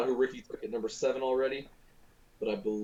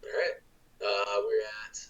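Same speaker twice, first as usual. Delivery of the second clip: usual speaking voice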